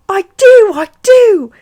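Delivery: put-on very high, loud child's squeal; hammed-up excited little-kid squeal. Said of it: "Harry squealed".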